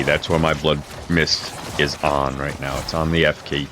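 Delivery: ordinary talk, nothing marked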